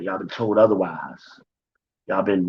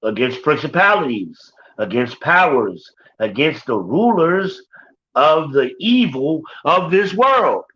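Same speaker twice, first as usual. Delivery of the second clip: full-force preaching; 6 semitones higher than usual. Acted. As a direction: preach it out, stretching each phrase.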